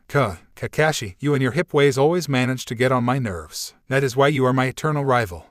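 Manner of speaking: usual speaking voice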